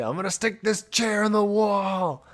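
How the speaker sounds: high, slow, drawn-out mock threat; mock rage. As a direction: imitate a raging gamer, hammed-up. Mockingly repeating the rager's threat.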